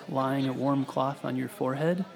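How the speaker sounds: usual speaking voice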